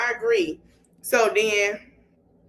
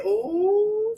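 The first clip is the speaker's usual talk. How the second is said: long, high-pitched "ooh"; scandalized ooh; 10 semitones higher than usual. Retold or imitated. Imitating an onlooker's reaction to the drama, scandalized ooh.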